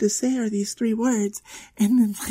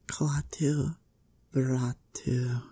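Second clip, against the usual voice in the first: tentative incantation; 6 semitones lower, 6 dB quieter, slower than usual. Acted. First attempt at reciting spell.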